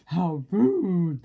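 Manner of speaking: loud shocked gasp of outrage. A narrator's reading. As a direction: gasp it out, scandalized. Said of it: "they gasped".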